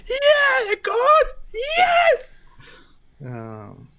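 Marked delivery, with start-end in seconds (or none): 0.1-2.4 very high, loud fake crying; mock wail. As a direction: wail it like pretend sobbing. Mock crying over deletion.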